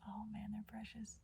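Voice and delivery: very soft, tender near-whisper; hushed adoration. Real, not acted. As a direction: murmur it, charmed by tiny birds. Watching the birds, moved.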